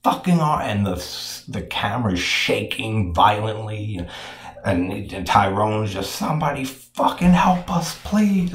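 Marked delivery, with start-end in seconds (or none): 0.0-0.6 retold scream. Movie fan retelling Harry screaming about his arm, mock scream. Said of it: "and he's screaming". 6.2-8.5 high-pitched imitated cry for help; desperate plea. Movie fan acting out a jailed man begging for help, desperate plea.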